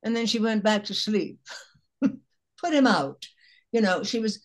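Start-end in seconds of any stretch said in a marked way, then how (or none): 2.0-3.2 re-deliver the tag line at half speed; slow repeat of punchline. Retold or imitated. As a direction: put him out, drawn out slow and high. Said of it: "She said".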